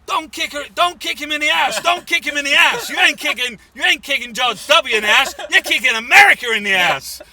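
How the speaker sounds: high, very loud screaming at the crowd; a stranger screams at people kicking a man in a Bush mask; furious scream. Retold or imitated. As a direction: scream it out in outrage. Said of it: "started screaming. He was going".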